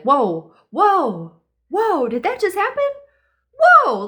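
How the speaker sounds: imitated stunned realisation, building; dawning amazement. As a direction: let each whoa climb in disbelief. Acting delayed amazement after meeting.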